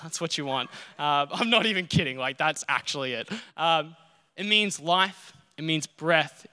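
ordinary delivery

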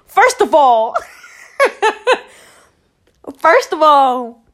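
high, loud retort through laughter; laughing indignation. Acted. Answering the nosy question, laughing and indignant. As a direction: laugh while starting the comeback.

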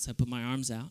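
usual speaking voice

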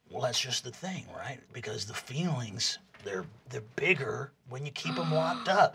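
low, soft, confiding voice; gentle counselor Jawbone. Acted. Jawbone talking to Rog about feelings, gentle. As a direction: drop low and quiet, talking someone through feelings.

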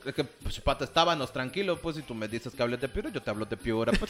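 low, put-on Piura accent. Guest defends talking like Piura, low Piura accent. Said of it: "Todo el rato va a estar con el acento".

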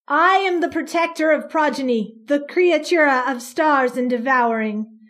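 loud, commanding boom; a creature booming over the mob. Acted. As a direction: fill the square with the voice. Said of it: "her voice booming".

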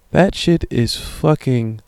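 ordinary delivery

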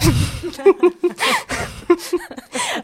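short sheepish laugh; embarrassed laugh. Real, not acted. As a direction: laugh at getting carried away. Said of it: "je m'emporte".

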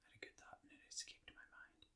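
hushed, quick whisper. Real, not acted. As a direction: whisper it like a secret aside. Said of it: "Why are we whispering?".

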